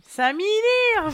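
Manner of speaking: high, very slow punning voice; high drawn-out pun. Pretend.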